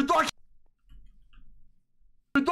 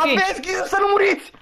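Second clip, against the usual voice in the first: high-pitched cry of warning; urgent warning; 9 semitones higher than usual. Real, not acted. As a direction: call out to warn the team, voice raised high. Warning teammates during chase.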